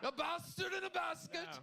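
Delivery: high mock shout. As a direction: bellow it up high for laughs. Host shouting mock question.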